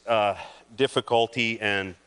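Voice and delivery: ordinary talk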